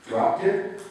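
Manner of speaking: high, rising disbelieving question; shocked questioning echo; incredulous echo. A narrator's reading. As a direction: repeat it back like you can't believe it.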